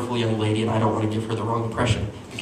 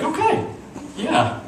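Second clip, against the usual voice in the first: taken-aback okay; slower than usual. Retold or imitated. Stunned reply to her sad story.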